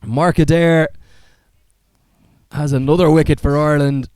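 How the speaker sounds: high, loud live call of a catch; excited cricket commentator. Real, not acted. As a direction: shout the wicket as it happens.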